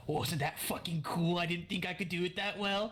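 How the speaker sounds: high, giddy mock whisper, fully voiced; excited confiding. Character boasting to Sir Wibbles after intimidating someone, excited confiding. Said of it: "start whispering to him".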